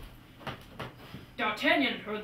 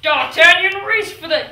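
loud retake; 6 semitones higher, 17 dB louder than usual. Shown as a demonstration. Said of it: "God, say it!".

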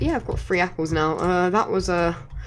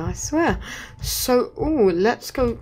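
usual speaking voice

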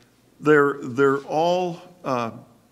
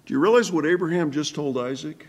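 ordinary delivery

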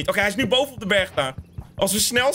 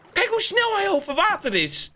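amazed; 11 semitones higher than usual. Real, not acted. Watching the fast hunter.

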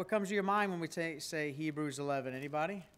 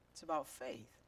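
soft echo; 4 semitones lower, 9 dB quieter than usual. Shown as a demonstration. Pastor echoing his point to the church, softer. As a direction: repeat "It's about faith" softer, dropping away.

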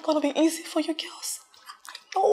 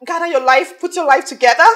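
firm loud ultimatum; 9 dB louder than usual. Acted.

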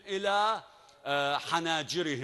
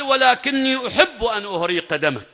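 louder and higher, firm and unshaken; defiant reply; 4 semitones higher, 7 dB louder than usual. Acted. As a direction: throw the words back at him. Ali defiantly answers Amr. (رد متحد بصوت أعلى وثابت)

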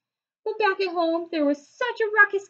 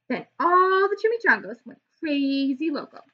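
ordinary delivery

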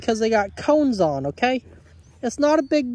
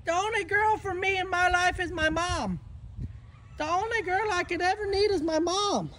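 voicing the dog; 6 semitones higher, 6 dB quieter than usual. Voicing Moose's thoughts.

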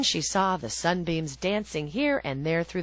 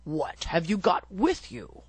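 wolf. A narrator's reading. Wolf voiced in a story.

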